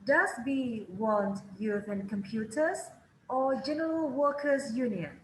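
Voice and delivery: teacher echoing the tape; rise then fall. Shown as a demonstration. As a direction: lift the first option, bring the second down. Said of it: "Pilihan pertama selalu naik".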